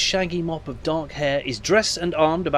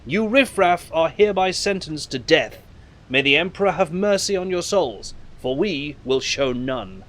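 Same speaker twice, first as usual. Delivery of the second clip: a lightly hammed-up formal officer, louder and a bit higher; best officer voice; 6 semitones higher, 6 dB louder than usual. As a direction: proclaim the death sentence like a stiff ship's officer. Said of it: "says in his best officer voice".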